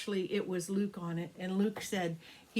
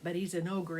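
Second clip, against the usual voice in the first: ordinary delivery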